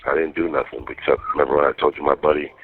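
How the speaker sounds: ordinary talk, nothing marked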